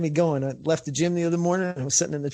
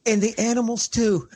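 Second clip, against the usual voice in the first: higher with a comic tag-on; deadpan afterthought, pitched up; God adding a punchline; 7 semitones higher, 3 dB louder than usual. Retold or imitated. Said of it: "he says at the end".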